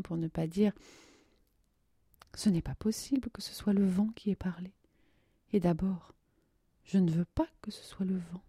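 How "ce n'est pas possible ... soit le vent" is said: whispered unsaid plea. Acted. Nadia holding back her words, whispered. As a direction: barely voice the wish.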